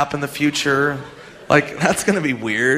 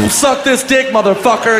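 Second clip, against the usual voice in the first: louder, rough comeback from the car; old man's crude comeback; 8 semitones higher, 4 dB louder than usual. Retold or imitated. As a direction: snap back as you drive off. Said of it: "and he drives away".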